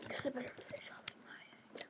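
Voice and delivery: quick hushed whisper to himself; sneaky whisper. Real, not acted. Player sneaking to guard his egg, hurried whisper.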